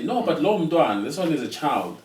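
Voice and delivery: retold scolding. Parent retells being scolded over the second child, mock scolding. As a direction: protest like a scolding elder. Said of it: "you are scolded and say".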